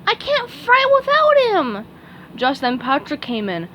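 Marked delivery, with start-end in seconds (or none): 0.0-1.8 very high, eager cartoon sponge voice; high squeaky sponge voice; SpongeBob voice. A narrator's reading. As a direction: chirp it like a keen little fry cook. SpongeBob speaks.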